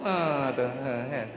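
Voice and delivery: ordinary talk, nothing marked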